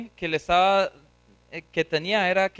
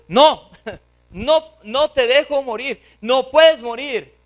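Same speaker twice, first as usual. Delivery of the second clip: loud, raised-pitch refusal; vehement protest; 6 semitones higher, 7 dB louder, slower than usual. Acted. Peter forbids Christ to die, vehement protest. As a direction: refuse outright, high and loud.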